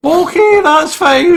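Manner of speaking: shrill-sounding high sarcastic okay; fake cheer. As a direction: sell forced delight through panic.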